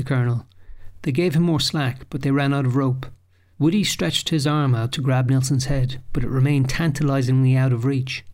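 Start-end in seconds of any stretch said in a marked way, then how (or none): none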